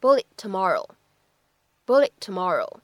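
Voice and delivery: bullet tomorrow with the t of bullet dropped; lost plosion. Shown as a demonstration. 老师示范 bullet tomorrow 的失去爆破. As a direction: bullet tomorrow, t swallowed before tomorrow. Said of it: "可以读成是".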